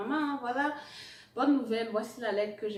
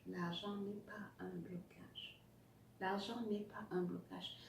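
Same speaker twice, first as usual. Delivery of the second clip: whispering; 11 dB quieter than usual. Acted. Repeats the point, whispering.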